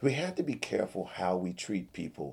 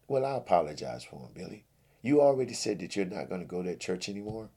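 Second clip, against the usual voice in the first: ordinary talk, nothing marked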